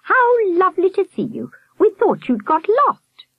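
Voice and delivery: high-pitched effusive welcome; welcoming hostess. A narrator's reading. Miss Hilliard greeting late Skip and Akela, effusive high welcome. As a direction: greet them in a high, gushing voice.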